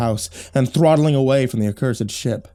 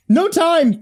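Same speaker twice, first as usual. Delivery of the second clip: put-on high, loud girl's yell; 3 dB louder than usual. A narrator's reading. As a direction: yell it over the chaos. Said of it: "the girl yelled".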